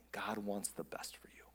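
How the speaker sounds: slow, low near-whisper; hushed assurance. Real, not acted. Pastor telling congregation what God wants, hushed. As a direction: drop to a soft, low hush.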